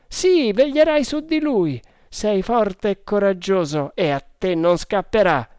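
high, loud character voice; hearty sergeant. Acted. Sergeant recruiting the brave hunter, hearty and loud. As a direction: speak up forcefully, pitched above the narration.

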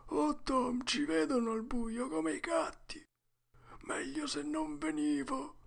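thin, high, soft whimper; frightened. A narrator's reading. Huck regrets coming to the graveyard, frightened. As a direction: shrink into a small high voice.

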